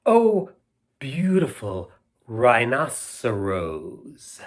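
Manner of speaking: grand mock-ode recitation; hammed-up ode to a rhinoceros; poem reading. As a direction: recite it like a lavish love poem, slowing at the end. Reads ode aloud to children.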